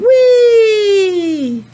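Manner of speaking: high, loud joyful squeal; crab whee. Acted. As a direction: ride the waves with glee.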